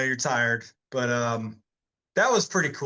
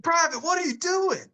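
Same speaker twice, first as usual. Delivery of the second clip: high-pitched mock alarm at usual volume; freaked-out drill sergeant. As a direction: imitate a sergeant baffled by a recruit. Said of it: "got all freaked out".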